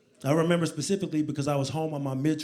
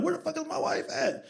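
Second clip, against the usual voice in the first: high-pitched, softer self-questioning; mock-bewildered, pitched up; puzzled wondering; 11 semitones higher, 6 dB quieter than usual. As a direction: ask yourself in a squeaky, baffled voice. Wondering where his missing wife is.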